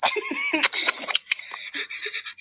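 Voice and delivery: breathy high-pitched cackle; squealing laugh. Real, not acted. A child losing it over a funny face, squealing. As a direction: let a high laugh burst out. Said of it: "Okay, calm down".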